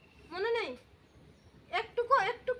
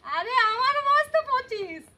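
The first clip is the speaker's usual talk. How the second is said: exasperated; 4 semitones higher, 7 dB louder than usual. Real, not acted. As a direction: raise your voice in disbelief. Exasperated with forgetful grandma.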